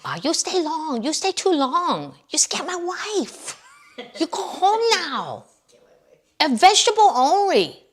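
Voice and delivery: high, loud mock Chinese-accent restaurant owner yelling at a customer; buffet owner in a mock Chinese accent throwing out a chubby customer, screaming. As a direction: shriek it out in a hammed-up Chinese accent, slow and stern. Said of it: "the owner's screaming at him".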